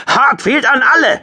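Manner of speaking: very high, loud shout; screamed call. A narrator's reading. Squadron leader to all ships in chaos, screaming. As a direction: yell over the chaos on the com. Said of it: "schrie Hartfield".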